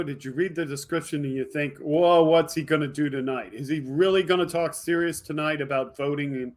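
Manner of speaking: ordinary talk, nothing marked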